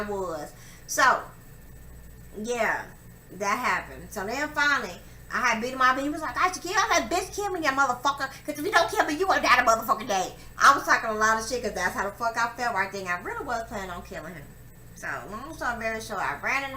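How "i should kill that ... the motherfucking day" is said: higher, heated retold threat; heated mock threat. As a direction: hurl the dare at her, raised. Retelling his threat after the fight.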